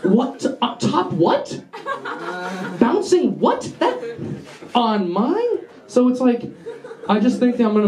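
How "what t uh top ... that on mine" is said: high, swooping, baffled questions; feigned surprise. Acted. Student disowning what's on his drive, feigned surprise. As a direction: play innocent with mock disbelief.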